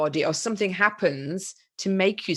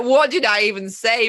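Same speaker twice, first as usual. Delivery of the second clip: baffled; 3 semitones higher, 7 dB louder than usual. Retold or imitated. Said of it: "I'm like".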